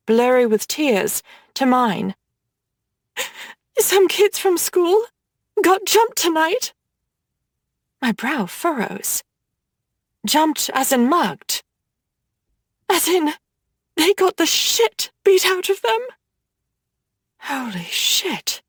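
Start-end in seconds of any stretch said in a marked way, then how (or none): 3.8-6.7 put-on higher, shaken teenage voice; a scared sister telling bad news; upset little sister. Upset younger sister Nora speaks. 12.9-16.0 put-on higher, shaken teenage voice; a scared sister telling bad news; upset little sister. Upset younger sister Nora speaks.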